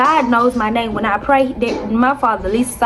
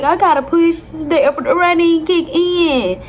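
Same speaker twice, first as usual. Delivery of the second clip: panicked; 5 semitones higher than usual. Retold or imitated. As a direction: plead in a panic. Panicking in labor retold.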